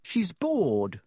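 lightly voiced Harry; young boy voice; boy's voice. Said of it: "he said".

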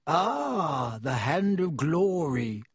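slow, ingratiating dealer; oily shopkeeper. Acted. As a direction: fawn over the customer. Shopkeeper Borgin voiced.

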